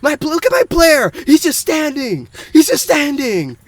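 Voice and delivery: high, loud mock complaint; hammed-up, exasperated sore loser; imitating frustrated gamer. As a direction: whine in outrage at a useless player. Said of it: "he was pretty frustrated".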